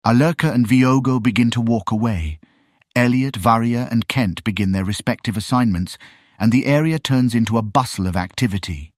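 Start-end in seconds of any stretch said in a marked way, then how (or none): none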